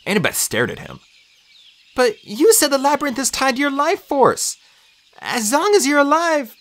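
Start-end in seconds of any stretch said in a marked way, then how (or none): 2.0-6.5 put-on high, worried girl's voice; anxious, much higher pitch; alarmed protest. A narrator's reading. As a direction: object as the truth dawns. Annabeth objects in alarm.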